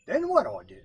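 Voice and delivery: high, eager question; eager raccoon. A narrator's reading. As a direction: ask it eagerly. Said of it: "asked Brother Coon".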